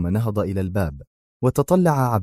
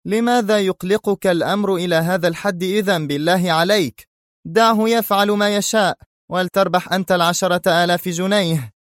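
much higher, pointed tone; sharp retort; 10 semitones higher than usual. Acted. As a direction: cut him off impatiently. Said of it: "ثم قال في حدة".

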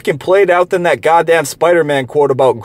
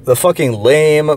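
ordinary talk, nothing marked

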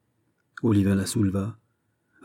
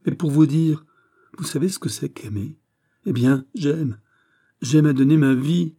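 higher, fervent confession of love; impassioned young heroine, pleading; 5 semitones higher, 7 dB louder than usual. A narrator's reading. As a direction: pour out her love in anguish. (jeune amoureuse exaltée et suppliante)

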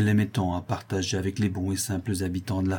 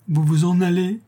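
high, broken by sobs; sobbing murmur; 8 semitones higher, 4 dB louder than usual. A narrator's reading. The prisoner sobbing to the departing girl, broken voice. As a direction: choke the question out through tears. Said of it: "d'une voix entrecoupée de sanglots".

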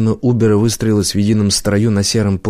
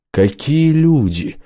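high, slow, drawn-out greeting; surprised exclamation; 6 semitones higher, much slower than usual. Acted. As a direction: stretch it out in mock surprise. Voice calls out in surprise.

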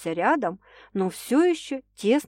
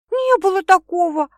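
high, louder shocked protest; wide-eyed denial; 11 semitones higher, 8 dB louder than usual. A narrator's reading. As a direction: deny it, scandalised. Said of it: "округлив глаза, возразила она".

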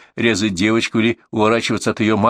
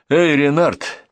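drawn-out hail, higher pitch; calling out; 5 semitones higher, much slower than usual. A narrator's reading. Narrator calls Renard out of the woodpile, hailing. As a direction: call to someone in hiding. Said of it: "крикнул я".

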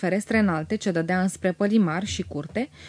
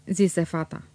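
plain reading voice

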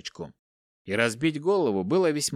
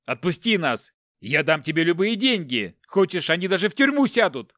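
high, loud, urgent pleading; desperate shouting; 6 semitones higher, 4 dB louder than usual. A narrator's reading. Captive father bargains with the departing captor, shouting. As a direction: shout offers to stop him leaving. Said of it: "закричал Дмитрий Олегович".

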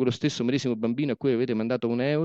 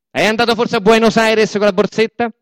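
loud sarcastic jab; 7 semitones higher, 10 dB louder than usual. Acted. Sarcastic question to the workers.

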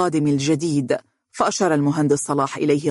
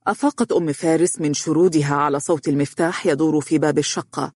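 usual speaking voice

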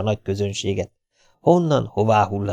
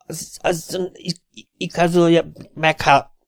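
flustered stammer; 8 semitones higher, slower than usual. A narrator's reading.

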